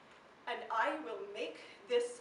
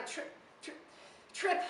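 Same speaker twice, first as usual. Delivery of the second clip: deliberate stutter on "trip", the last one pitched up; stumbling over words; 6 semitones higher than usual. Acted. Poet shows tripping over words, halting repeats of "trip". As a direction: catch and break on "trip" before getting it out. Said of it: "over my words".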